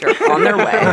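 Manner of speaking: laughing tease; joking through laughter. Real, not acted. Teasing colleague about squash.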